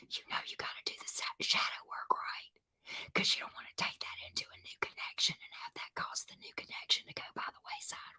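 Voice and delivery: soft unvoiced whisper. Real, not acted. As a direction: lean in and whisper the secret advice. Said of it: "whispering private advice".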